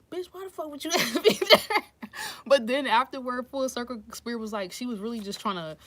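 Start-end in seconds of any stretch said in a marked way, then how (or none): 0.1-1.8 high, loud retold outburst cracking into laughter; mock indignation dissolving into a laugh; laughing mock outrage. Reader recalling her reaction to a client's question, laughing mock outrage.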